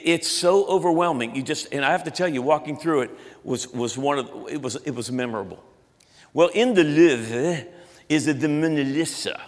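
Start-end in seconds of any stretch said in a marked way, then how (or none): 6.3-9.4 slow, hammed-up French accent; deliberately silly French accent; bad French accent. Shown as a demonstration. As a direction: draw it out in cartoon French. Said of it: "that's not a good French accent".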